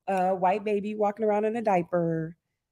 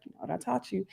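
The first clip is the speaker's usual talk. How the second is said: imitating a father keeping his voice down; much softer, near-whispered reminder; hushed reply; 11 dB quieter than usual. Retold father answering hiding daughter.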